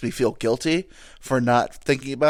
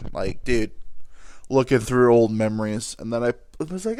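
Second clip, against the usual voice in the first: normal talking voice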